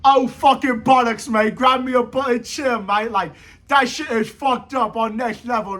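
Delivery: lightly voiced mocking British bloke; a hint of a British accent, mocking. Mocking a European fan in a light British accent. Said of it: "the European dude be like".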